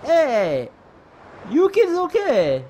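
loud, high Italian chef voice; Italian accent. Acted. Panky the chef calling to Chief about kids on the beach, Italian accent. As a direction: call out with a put-on Italian accent. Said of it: "I gotta give him the Italian voice".

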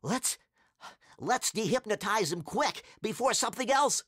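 high, urgent panting; out of breath. A narrator's reading. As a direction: gasp between words after running away. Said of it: "to catch their breath".

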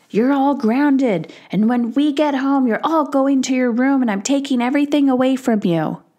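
hammed-up threat of punishment; mock strict parent. Mother shows the punishing rant she never gave her kids. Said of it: "I didn't say".

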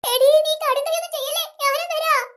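usual speaking voice

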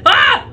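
sudden high bark of disbelief; a startled shout; high shocked "ha". Real, not acted. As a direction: gasp in shock. Shocked exclamation.

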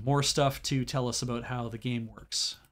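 usual speaking voice

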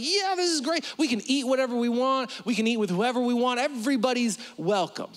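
high, fast and eager; excited Peter. Retold or imitated. Preacher voicing Peter, high and eager. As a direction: gush like a thrilled Peter. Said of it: "He's like".

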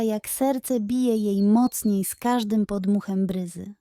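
ordinary delivery, nothing marked

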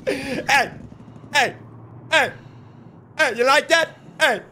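gloating you like that call-out; taunting. Real, not acted. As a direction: rub it in, gloating. Taunting a downed enemy.

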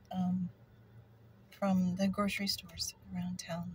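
ordinary delivery

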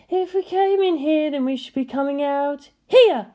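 put-on light, high young woman's voice; Misato's voice. A narrator's reading. Narrator voicing Misato, lost in the corridors, puzzled. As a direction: raise the pitch for Misato.

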